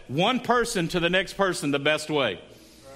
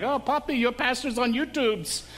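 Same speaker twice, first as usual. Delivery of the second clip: put-on higher-pitched Panamanian accent; 3 semitones higher than usual. Mom from Panama talking about her pastor, imitated Panamanian accent. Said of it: "and with her accent".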